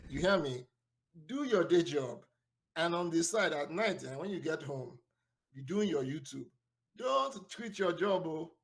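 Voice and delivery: playful Nigerian uncle accent. Shown as a demonstration. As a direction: scold gently in a Nigerian accent. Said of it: "my Nigerian accent".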